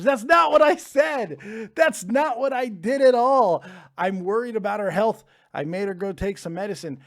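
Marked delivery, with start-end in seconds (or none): 0.0-3.6 loud, high, slowed protest; mock-outraged yell at the chat; indignant shout. Real, not acted. Denying a chat comment, indignant.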